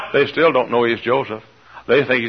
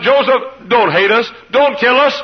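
pleading; 10 semitones higher, 4 dB louder than usual. Acted. Benjamin pleading for brothers.

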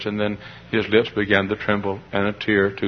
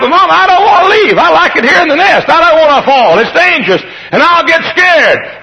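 put-on very high, loud child's wail; hammed-up panicky protest; frightened pleading; 12 dB louder than usual. Eaglet begs Mama not to throw him out, frightened wail.

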